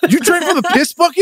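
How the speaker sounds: high incredulous shout; shocked yell. Acted. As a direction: yell it in disbelief. Shocked brother yelling.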